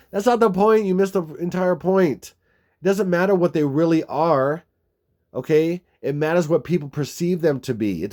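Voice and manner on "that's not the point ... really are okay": exasperated. Real, not acted. Vlogger scolding commenters who missed the point, exasperated. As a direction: snap back at them.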